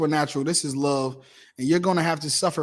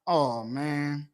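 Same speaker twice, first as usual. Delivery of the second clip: usual speaking voice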